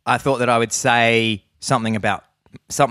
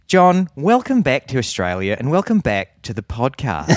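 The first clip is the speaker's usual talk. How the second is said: broad Australian politician impression, pitched up; Julia Gillard; 4 semitones higher than usual. Host welcoming co-host back to Australia, broad Australian impression. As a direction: lean hard into broad Aussie vowels, higher. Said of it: "my impression of Julia Gillard".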